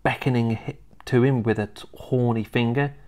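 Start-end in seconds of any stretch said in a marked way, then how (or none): none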